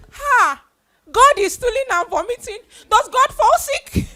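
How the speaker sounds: high, loud, incredulous outburst; shocked exclamation. Retold or imitated. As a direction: gasp in disbelief that God could be sick. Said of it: "And I was like".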